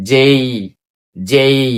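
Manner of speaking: "জেই" as je plus a short i, joined; spelt-out pronunciation. Shown as a demonstration. Teacher spells the sound then models it, "জেই" with glide. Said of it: "জয়কার জে".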